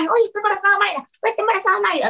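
high, tearful mock sob through the words; imitating her own crying. Retelling her tearful pregnancy news to a friend, mock sobbing. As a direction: replay the tearful call, lightly acted. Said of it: "yo llorando en la cama".

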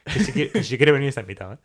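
normal talking voice

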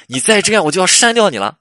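loud, high exasperated ultimatum; angry threat. Acted. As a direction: act out a girlfriend at the end of her patience. Acted girlfriend threatens to delete him.

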